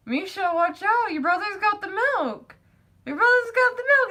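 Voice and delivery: high, teasing mock alarm; imitated dad warning. Dad teasingly warning child, acted.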